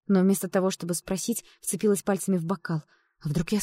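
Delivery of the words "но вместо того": usual speaking voice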